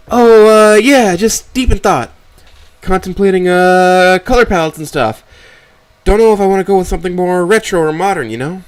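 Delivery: loud, high-pitched, stalling daughter voice; flustered daughter. Acted. Voices Amber making excuses.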